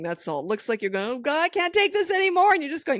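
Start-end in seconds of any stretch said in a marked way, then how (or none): 1.1-2.5 high, loud imitation of a panicking parent; hammed-up exasperated panic; mock panic. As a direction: act out a parent at the end of their rope, high-pitched. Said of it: "You're just panicking".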